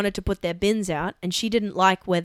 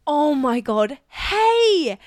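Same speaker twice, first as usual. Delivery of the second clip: excited greeting; 5 semitones higher, 4 dB louder, much slower than usual. Retold or imitated. Greeting neighbour, retold excitedly.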